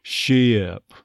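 "Ship" stressed as shi-uhp; off-glide "Ship". Shown as a demonstration. Coach shows a stressed last syllable, gliding "Ship". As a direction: stretch "Ship" with a slide into uh. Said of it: "get that off glide".

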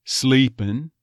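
"Sleepin'" ending on N; dropped G. Shown as a demonstration. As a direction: say "Sleepin'" with no G, glide onto the E. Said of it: "Dropping the G".

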